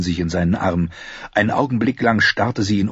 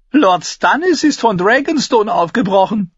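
high, loud news-breaking; urgent announcement; 13 semitones higher, 6 dB louder than usual. Acted. Tyrion springing news of Stannis on his sister, high and loud. Alarming news, she jumps up.

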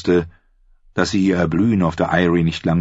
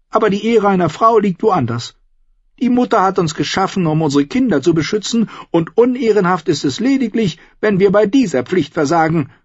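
high, loud, ranting Lysa; bitter aunt; 4 dB louder than usual. A narrator's reading. Aunt Lysa rants to Sansa about Tyrion, high and heated. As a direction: rail against the dwarf, spiteful.

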